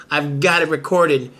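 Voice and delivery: usual speaking voice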